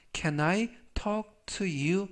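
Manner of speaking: very slow, clear English; slow demo reading. Shown as a demonstration. Teacher models the sentence for students, slowly. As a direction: spell it out slowly for the class. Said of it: "제가 천천히 세 번씩 읽어드리고요".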